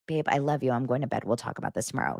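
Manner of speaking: low, soft, calm husband. Retold or imitated. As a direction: settle her down gently, low. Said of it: "Lance wouldn't even be like".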